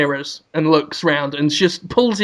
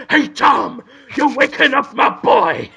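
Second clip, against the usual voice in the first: higher tough cartoon bulldog; Spike the bulldog; 5 semitones higher than usual. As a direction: growl it like a cartoon dog guarding his pup. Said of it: "He is now also Spike".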